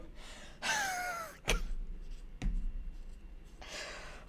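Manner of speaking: high, breathless laughing fit; long wheezing laugh. Real, not acted. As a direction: crack up until the sound gives out. Cracking up at chat.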